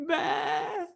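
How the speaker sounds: imitated crying child's high-pitched baa; tearful sheep bleat. Son answering dad's sheep question, kinda crying. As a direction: bleat like a sheep, mimicking a tearful little boy. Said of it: "he's kinda crying".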